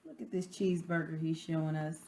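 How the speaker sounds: usual speaking voice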